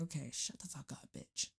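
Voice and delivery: muttered inner put-down. Retold or imitated. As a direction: hush it down to a low snarl. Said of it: "The one that you're like".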